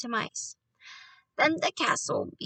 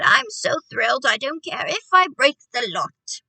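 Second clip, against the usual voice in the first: loud, reckless excitement; thrilled shout; 8 dB louder than usual. A narrator's reading. Grandmother too thrilled to care, shouting. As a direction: shout it out, carried away. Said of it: "she shouted".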